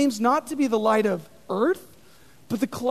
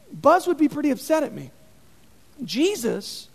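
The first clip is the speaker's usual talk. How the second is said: ordinary talk, nothing marked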